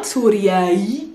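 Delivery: Syriai drawn out as "soo-ria-EE"; wrong adjective form. Shown as a demonstration. Teacher showing the mistake, Syriai with stretched extra ending. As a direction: stretch a separate "ee" onto the end of Syriai. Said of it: "is not Syriai".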